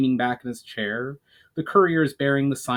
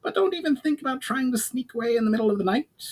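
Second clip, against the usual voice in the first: higher goblin voice, quick and wary; a goblin warning off a sneaky elf, faster; 10 semitones higher than usual. A narrator's reading.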